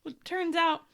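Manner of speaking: normal talking voice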